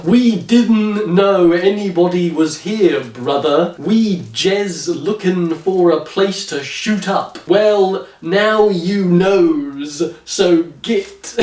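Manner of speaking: slow, sarcastic fake street accent. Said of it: "I will read it in an approximation".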